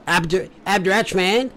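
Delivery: put-on laughing bogan Australian accent, loud; broad Australian Abdurrahman. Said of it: "say it in a bogan Australian accent".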